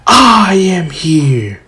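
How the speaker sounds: slow, loud, booming declaration; heroic entrance. Retold or imitated. All Might arriving to save the students, slow booming. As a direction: announce yourself like a big hero.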